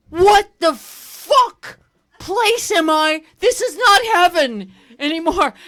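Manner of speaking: put-on high, slow baby outrage; a two-year-old waking up to the world, high and appalled; shocked toddler voice. Comic voicing a toddler, high and loud in disbelief. Toddler realizing not heaven.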